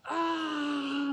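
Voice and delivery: high, loud anguished "Ah"; furious cry as the truck drives off; angry cry. Retold or imitated. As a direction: let out a cry of rage. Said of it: "I'm just like".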